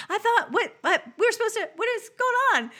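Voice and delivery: high, loud flustered sputtering; mock indignant protest. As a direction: sputter in outraged disbelief, imitating him. Acts out character's baffled protest.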